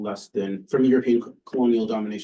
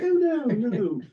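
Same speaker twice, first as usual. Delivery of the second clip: high, dismissive mock-Oxford voice; British Oxford accent; 13 semitones higher, slower than usual. British professor rejecting the student's answer, put-on Oxford accent. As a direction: brush the answer aside as a British don. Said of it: "this is my best British Oxford accent".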